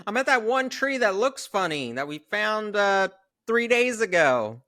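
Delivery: high, loud silly voice; mocking high voice. Joking about the missing friend, high mock voice. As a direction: ham up the dumb line. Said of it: "mocking Mike's line".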